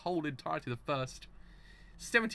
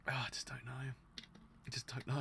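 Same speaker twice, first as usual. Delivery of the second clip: whispered, almost no voice; defeated whisper; 2 semitones lower, 13 dB quieter, faster than usual. Real, not acted. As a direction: breathe it out, giving up. Despairing mutter after rant.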